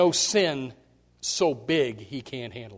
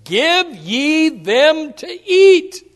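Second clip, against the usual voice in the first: very slow, louder solemn command; slow, weighty lightly voiced Jesus; 12 semitones higher, 14 dB louder, much slower than usual. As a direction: lay out each word like a command from Jesus. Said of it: "He says".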